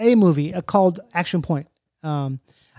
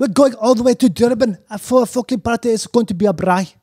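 lively, higher-pitched South African accent; bad South African accent; 4 semitones higher, 4 dB louder than usual. Shown as a demonstration. Guest shows off the accent for the hosts, lively rough South African accent. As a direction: keep up a rough Afrikaans bro accent. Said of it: "like, a really bad version of it".

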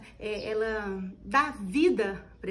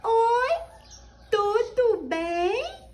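high, slow syllable by syllable doll voice; puppet voice; 10 semitones higher, 6 dB louder, much slower than usual. Shown as a demonstration. Teacher showing puppet lip-sync, high and slow. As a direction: open the mouth on every syllable as the doll. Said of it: "abrir e fechar a boca em cada sílaba".

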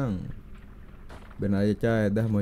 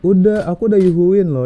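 calling out loudly and higher; 6 semitones higher, 10 dB louder than usual. Real, not acted. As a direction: raise the voice to be heard over voice chat. Calling out to ignored teammates.

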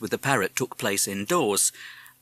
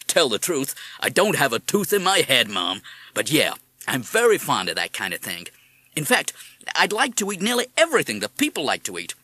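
American parrot voice; 3 semitones higher, 3 dB louder than usual. Acted. Madison talks to Mrs Holsworth.

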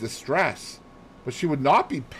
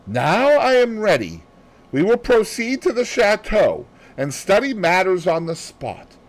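higher, brisk and fussy; brisk and ready, fussing over the tie; Poirot voice; 7 semitones higher than usual. Acted. Poirot fusses over Hastings's tie, brisk and high.

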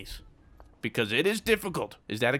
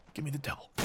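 quiet pleading murmur; 11 dB quieter, much faster than usual. Real, not acted. Fading murmur.

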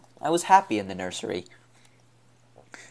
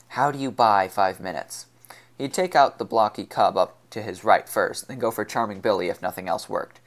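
ordinary delivery, nothing marked

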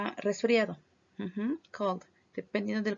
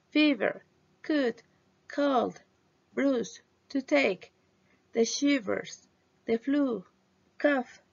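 clear, careful English list, slightly higher; modeling words for repetition; 3 semitones higher, slower than usual. Shown as a demonstration. Teacher models vocabulary for class to repeat. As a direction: read each word for students to repeat.

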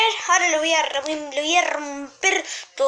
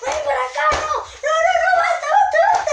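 very high, frantic pretend rage; mock fight yelling; 10 semitones higher, faster than usual. Fighting the friend Juan for insults, high-pitched mock fury. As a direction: squeal threats as if in a play fight.

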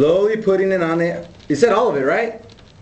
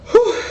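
sharp high whew, blowing out the heat; 14 semitones higher, 4 dB louder than usual. Real, not acted.

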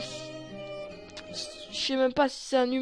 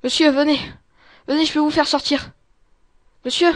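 loud, raised-pitch call; urgent calling out; 4 semitones higher, 11 dB louder, faster than usual. Acted. Escapee calling a prisoner to come out, urgent shout. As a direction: call him over urgently.

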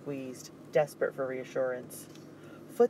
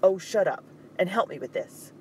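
loud, much higher snapped order; harsh retort; 5 semitones higher, 6 dB louder than usual. Acted. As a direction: cut him off sharply. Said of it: "Dupree's harsh one".

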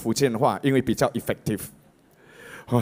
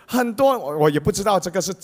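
ordinary talk, nothing marked

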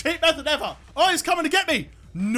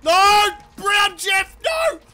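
frantic shout; 8 semitones higher, 5 dB louder, much slower than usual. Real, not acted. Opponent grabs him in game.

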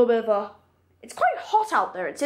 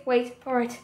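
normal talking voice

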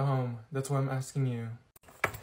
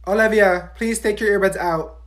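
ordinary talk, nothing marked

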